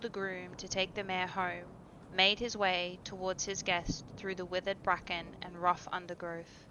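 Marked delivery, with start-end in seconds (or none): none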